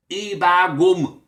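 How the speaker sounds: loud, slow, high Geordie catchphrase. Shown as a demonstration. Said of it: "stereotype way of sounding in terms of Geordie".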